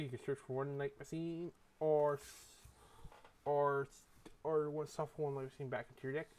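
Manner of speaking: ordinary talk, nothing marked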